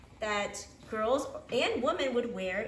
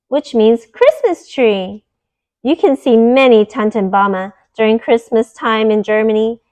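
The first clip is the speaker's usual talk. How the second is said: bright, clear storybook read-aloud voice; louder, lively storyteller reading to little ones; reading to children; 19 dB louder than usual. Acted. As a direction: read it like a bedtime picture book for kids. Reads picture book aloud.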